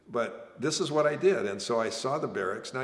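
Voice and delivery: ordinary talk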